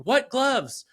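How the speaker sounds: put-on high-pitched little-kid demand; mock child's puzzled cry; imitated daughter's outcry. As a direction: pipe up like an insistent child. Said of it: "Amelia starts saying".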